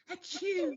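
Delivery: high squeaky fake sneeze; a dainty pretend achoo; mock dainty sneeze. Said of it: "the dainty sneezes that I mock".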